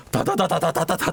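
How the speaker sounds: rapid vocal rat-a-tat; imitated gunfire. Describing armed villagers fighting the monster, mock gunfire. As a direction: mimic machine guns with the mouth.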